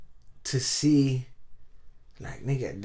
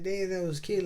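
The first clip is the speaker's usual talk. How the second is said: higher cooing girly mock voice; mock cheerleader; 8 semitones higher than usual. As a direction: squeal it like an impressed cheerleader. Cheerleaders react, voiced by teller.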